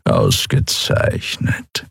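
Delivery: low, slow, breathy cat purr. Acted. Old tomcat Chasu purring to his servant, low and slow. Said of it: "schnurrte Chasu".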